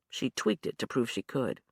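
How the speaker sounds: ordinary delivery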